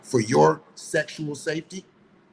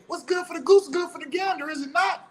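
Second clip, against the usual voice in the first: incredulous challenge; 12 semitones higher than usual. Real, not acted.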